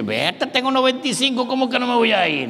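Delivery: high, put-upon voice of the dying grandfather; mock old grandfather. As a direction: play the grandfather waving them off. Grandfather answers mourners.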